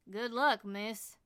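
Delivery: put-on kindly Southern cab driver accent; Southern accent tipping his hat farewell. A narrator's reading. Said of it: "in his heavily southern accent".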